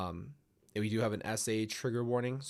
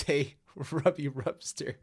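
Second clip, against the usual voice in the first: laughing; 5 semitones higher, 3 dB louder, slower than usual. Real, not acted. Reading a fan's chat greeting.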